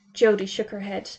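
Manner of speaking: usual speaking voice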